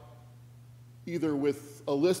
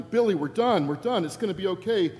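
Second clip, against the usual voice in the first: relieved exclamation; 5 semitones higher, 5 dB louder than usual. Retold or imitated. Dad finally done, relieved.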